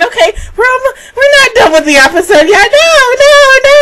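high, fast frantic denial; panicked inner voice. Retold or imitated. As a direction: plead with the show not to end. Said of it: "and I'm thinking".

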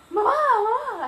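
loud, high, hammed-up call for mum; dramatic cry. Bitten girl calling her mother, dramatic re-enacted scream. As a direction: wail it out with mock drama. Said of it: "así como muy dramáticamente".

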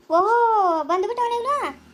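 a sudden high "oho", mocking his nerve; sarcastic surprise. Elder sneering as the son appears, in mock surprise. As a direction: act shocked that he dared come back.

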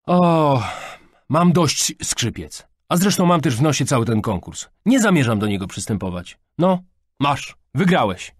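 loud, high exasperated outburst; groaning teenage exasperation; fed up. A narrator's reading. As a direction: give up in a huff. Fed-up daughter quits contest.

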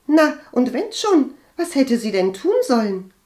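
high, loud, indifferent retort; defiant excuse. A narrator's reading. Cousin excuses her mother to Agathe, defiant.

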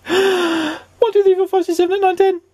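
very high, steady counting; counting Mister Jelly. A narrator's reading.

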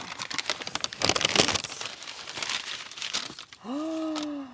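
sharp airy gasp of delight; breathless gasp at a gift. Real, not acted. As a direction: gasp in at the surprise. Opening a gift on camera.

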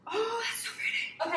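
high, slow, tearful oh; near tears. Real, not acted. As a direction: voice cracking with emotion. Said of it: "I'm genuinely gonna cry".

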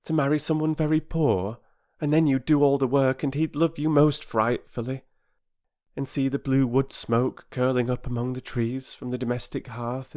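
put-on high, dreamy girl voice; romantic girl. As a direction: daydream aloud as a romantic child. Voices Bobby daydreaming of marriage.

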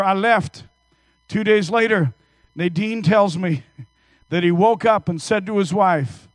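ordinary talk

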